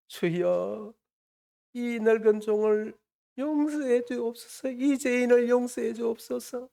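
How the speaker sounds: high, drawn-out monotone plea; imitated tearful prayer. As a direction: act out an old mother's pleading prayer. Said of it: "그렇게 눈물을 흘리면서 기도하고 계시는 거예요". (늙은 어머니의 애원 기도를 길게 늘여 흉내)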